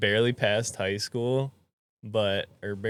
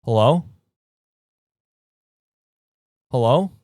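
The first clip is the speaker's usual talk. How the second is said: calling out from underground; 3 semitones higher, 12 dB louder than usual. Retold or imitated. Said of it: "even better would just be like".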